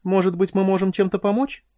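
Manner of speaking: lightly acted guileless question; innocent offer. A narrator's reading. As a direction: offer help in all innocence. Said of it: "невинно произнесла Куин".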